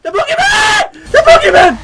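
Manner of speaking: very high, loud shriek; full-volume panicked shrieking; terrified scream. Real, not acted. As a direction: scream the monster's name in terror. Screams on seeing the monster.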